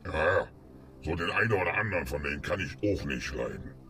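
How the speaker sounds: usual speaking voice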